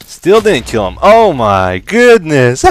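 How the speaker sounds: loud, high cry of disbelief; exasperated outcry. Real, not acted. Gamer whose foe won't die, exasperated outcry. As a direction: cry out, stretching "oh my goodness". Game fight goes badly.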